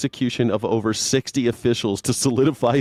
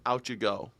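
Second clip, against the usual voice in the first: much softer, dropped down; quiet repeat; 2 semitones lower, 10 dB quieter than usual. Retold or imitated. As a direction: let it fade almost to nothing. Dismissing the accused.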